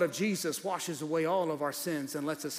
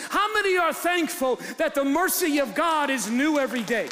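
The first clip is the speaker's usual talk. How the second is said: higher and louder, driving hard; preaching at full force; 9 semitones higher, 4 dB louder than usual. Real, not acted. Pastor preaching at full force to the congregation about his truth. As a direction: shout it from the pulpit like a climax.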